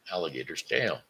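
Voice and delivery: plain reading voice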